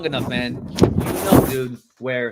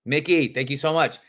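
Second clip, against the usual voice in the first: ordinary talk, nothing marked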